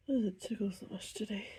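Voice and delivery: normal talking voice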